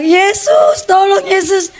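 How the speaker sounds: mock wail for help without full volume; retold cry out. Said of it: "Pas saya teriak". (teriakan minta tolong, tiruan)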